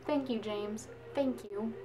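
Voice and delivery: quietly thanking James for sticking up for her; soft thanks. Retold or imitated. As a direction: murmur thanks to the nice guy.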